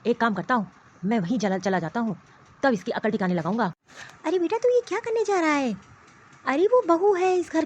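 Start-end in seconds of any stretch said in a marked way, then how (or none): 0.1-3.7 lower, menacing voice of a furious husband; a possessive man fuming and threatening; angry husband. Acted. Husband rages to mother that his wife left without asking.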